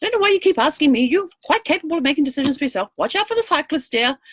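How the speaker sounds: bossy wife. Retold or imitated. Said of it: "she says".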